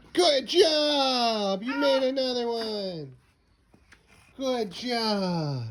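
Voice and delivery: proud excited cheer; thrilled praise. Real, not acted. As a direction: celebrate the basket. Toddler scores basket.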